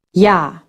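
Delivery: "Ja" opening with an English Y sound. Shown as a demonstration. Teacher demonstrating German J, pronounced like English Y. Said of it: "always pronounced like English Y".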